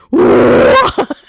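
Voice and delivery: imitated burst of loud disbelief; shocked shout. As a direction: shout it like the startled man. Retelling man's shock at hidden voice.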